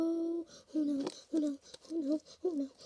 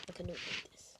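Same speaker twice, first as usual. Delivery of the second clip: whisper; 8 semitones lower, 17 dB quieter, much faster than usual. Real, not acted. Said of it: "just gonna do some A S M R".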